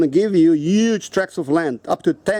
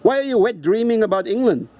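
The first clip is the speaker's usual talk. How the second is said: ordinary talk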